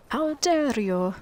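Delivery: hammed-up, drawn-out outrage; mock indignation. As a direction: draw it out like someone affronted. Voices the offended orc lady.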